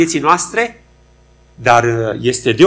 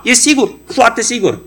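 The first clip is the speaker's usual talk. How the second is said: high-pitched, certain questioner and witness; mock witness reply; 8 semitones higher than usual. Researcher asks witness, high mock-certain voices. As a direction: act out a sure witness, voice lifted high.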